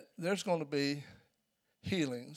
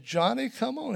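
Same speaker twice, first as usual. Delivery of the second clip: frantic father; 8 semitones higher, 7 dB louder than usual. Retold or imitated. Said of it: "He's crying".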